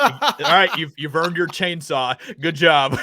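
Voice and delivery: loud, laughing mock dad congratulating his son; laughing parody of a dad at a sixteenth birthday; proud dad. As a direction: play a proud father handing over a chainsaw. Imitating proud parent giving chainsaw.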